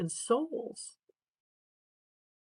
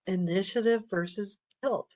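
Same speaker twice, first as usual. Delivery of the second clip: ordinary talk, nothing marked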